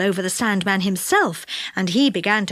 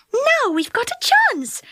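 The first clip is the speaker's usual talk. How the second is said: high, delighted fairy voice; pleased; 14 semitones higher than usual. A narrator's reading. Fairy seeing the Sandman asleep, high and pleased. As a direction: say it brightly, pitch well up.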